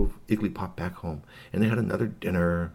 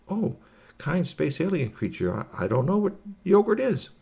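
courteous little space creature, pitched up; polite alien; 10 semitones higher than usual. Acted. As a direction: puzzle it out in a high voice. Alien answers the hostess.

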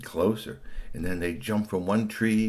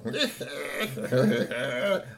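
snarling cackle; villain's snarl; 4 semitones higher than usual. A narrator's reading. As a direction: snarl out a mean creature's laugh. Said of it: "Meanie just snarled".

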